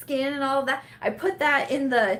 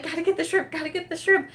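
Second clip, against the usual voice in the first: higher, mock-flustered muttered chant; frantic self-urging; 7 semitones higher than usual. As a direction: urge yourself on in mild panic. Retold frantic self-urging while cooking.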